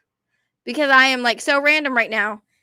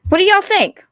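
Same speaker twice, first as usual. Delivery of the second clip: usual speaking voice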